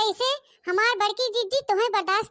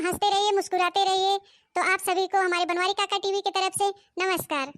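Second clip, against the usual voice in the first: normal talking voice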